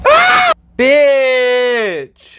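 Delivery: high, loud squeal of delight; shriek, then stretch the word out; thrilled shriek. Real, not acted. Vlogger living her nineties fantasy, shrieking in delight.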